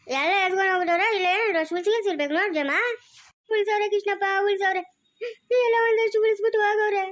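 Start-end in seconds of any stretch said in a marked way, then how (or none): 3.5-7.0 tearful wailing. Acted. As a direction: answer through a wail. Robbed woman wails her answer. (ಗೋಳಾಡುತ್ತಾ ಉತ್ತರಿಸುವುದು)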